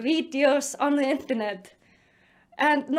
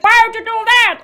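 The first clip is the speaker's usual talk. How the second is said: raised, loud American-accented yell; 5 semitones higher, 7 dB louder than usual. Shown as a demonstration. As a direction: try an American accent while yelling. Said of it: "yelling this in American accent".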